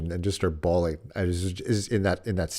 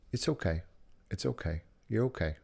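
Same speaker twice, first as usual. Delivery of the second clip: soft reassurance; 7 dB quieter than usual. Retold or imitated. Daughter's unspoken comfort.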